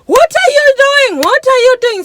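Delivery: high, loud yell, repeated; retold yelling. Drivers all yelling at each other in traffic, high imitated yelling. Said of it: "we are all yelling at each other".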